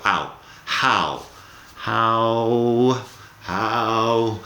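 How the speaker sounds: "how" said as an H followed by the "ow" diptong, with no W sound; repeated how demo. Shown as a demonstration. Teacher repeating "how" several times so students can copy it. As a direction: glide "how" from ah to oo after a breathy H. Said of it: "como si fuera H y un diptongo".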